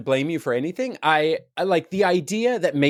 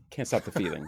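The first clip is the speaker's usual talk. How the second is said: quiet, laughing fix of the title; embarrassed self-correction, softer and laughing; 7 semitones lower, 9 dB quieter than usual. Real, not acted.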